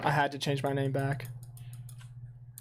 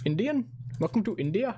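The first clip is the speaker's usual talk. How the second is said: higher mock Indian accent; put-on Indian accent, pitched up; 6 semitones higher than usual. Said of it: "His Indian accent's so good".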